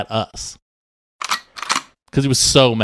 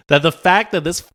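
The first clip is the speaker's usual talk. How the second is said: mock outrage; 8 semitones higher, 3 dB louder, slower than usual.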